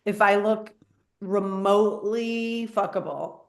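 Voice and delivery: ordinary talk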